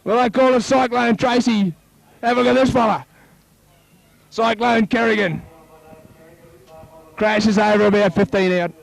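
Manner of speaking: high, rising play-by-play as the try is scored; excited try call. Real, not acted. Live try call rising to scoring.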